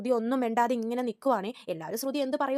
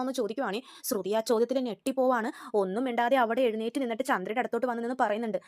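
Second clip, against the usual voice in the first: ordinary talk, nothing marked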